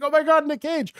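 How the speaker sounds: very high, gushing pretend excitement; hammed-up fan freak-out; mock fan frenzy. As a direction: squeal like the starstruck internet. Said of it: "everyone on the internet's like".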